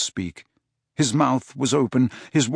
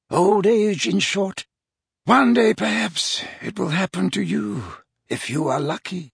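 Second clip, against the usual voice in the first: put-on wry old wizard; 7 semitones higher than usual. Dumbledore wry.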